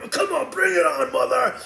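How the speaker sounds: high, loud, strained dare; puffed-up brawler calling someone out; fighting taunt. Acted. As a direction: goad him into throwing a punch. Acting out squaring up to fight.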